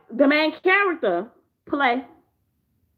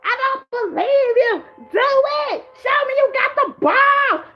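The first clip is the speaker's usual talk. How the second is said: much higher and louder, slow, goading shout; taunting yell; 11 semitones higher, 3 dB louder, slower than usual. Retold or imitated. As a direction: egg her on, yelling. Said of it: "was like".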